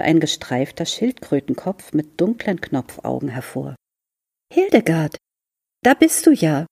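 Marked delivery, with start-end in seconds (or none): 4.5-6.7 rising high and a bit louder on the discovery; a kid spotting his pet at last; relieved boy's voice. A narrator's reading. Fritz finds his turtle behind the plants, relieved and high.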